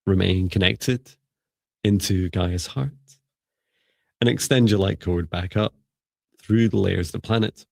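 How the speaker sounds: calm, even meditation voice; guided meditation. Acted. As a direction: guide them gently through the breathing.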